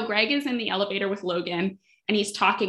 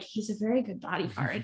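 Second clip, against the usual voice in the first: soft, much quieter aside; mild awkward compliment; 7 dB quieter than usual. Retold or imitated. Podcaster imitating Greg politely praising the bodyguard, softly. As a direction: murmur it, half to himself. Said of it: "Greg is quite kind of like".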